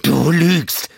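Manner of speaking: high, loud, wild snarl. Acted. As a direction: snarl the accusation. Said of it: "mit einem wilden Knurren". (wild knurrend und laut)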